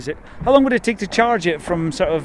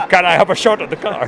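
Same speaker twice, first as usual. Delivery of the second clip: a deliberately bad Dutch accent, a bit louder; 3 semitones higher, 7 dB louder than usual. Asking Tesla man for a drive, bad Dutch accent. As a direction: ham up a clumsy Dutch accent while laughing. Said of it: "my worst Dutch accent ever".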